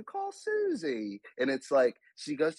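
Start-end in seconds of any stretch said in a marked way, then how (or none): 0.0-1.2 put-on much higher, slow, prim British child; British cartoon accent. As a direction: say it like Peppa in her British accent, drawn out. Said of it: "you know how they have that accent too".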